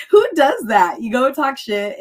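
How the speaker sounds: high, loud, laughing disbelief; incredulous laughter. Real, not acted. Telling host about being blocked, laughing in disbelief. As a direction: protest through real laughter.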